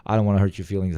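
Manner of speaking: ordinary delivery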